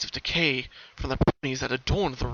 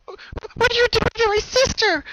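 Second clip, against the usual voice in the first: put-on high, loud, desperate little girl voice; a small child crying out in terror; crying young filly; 9 dB louder than usual. Young Pickamina crying from her cage, high and frantic. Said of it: "the young Pickamina cried".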